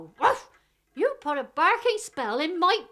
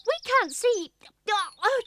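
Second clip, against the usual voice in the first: ordinary talk, nothing marked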